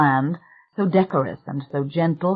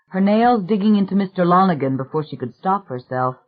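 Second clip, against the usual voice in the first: plain reading voice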